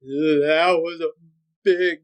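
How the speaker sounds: mock-scared, dragged-out disbelief; spooked dad. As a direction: imitate someone shaken, drawing every word out. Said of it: "never seen him so scared".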